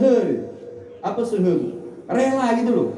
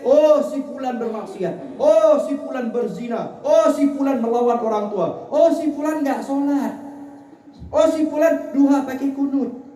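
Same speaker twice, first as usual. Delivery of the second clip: high-pitched litany of others' sins; mock tattling report; 6 semitones higher than usual. Acted reports of others' misdeeds.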